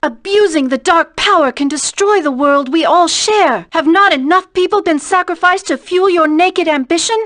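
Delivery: loud, furious accusation; angry rebuke. Acted. Hero condemning the villain's ambition, a loud angry rebuke. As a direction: hurl the charge at him.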